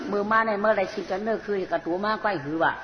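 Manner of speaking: ordinary delivery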